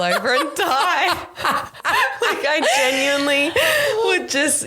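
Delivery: high laughing voice breaking into a laugh; real laughter cracking the words. Real, not acted. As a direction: laugh through it, pitch jumping up. Laughing at the thought.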